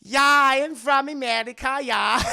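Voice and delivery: put-on loud, high German-accented "American"; badly faked American. Elsa passing herself off as American, thick German accent. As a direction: declare it with a hammed-up German accent posing as American. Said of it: "look at her voice".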